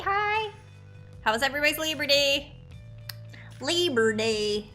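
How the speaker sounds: normal talking voice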